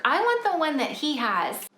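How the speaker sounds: mock kid whine; whiny complaint. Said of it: "grumble and complain".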